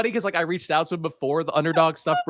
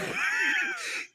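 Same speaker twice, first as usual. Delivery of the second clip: high squealing laugh; 7 dB quieter than usual. Acted. Squeaky laugh ends impression.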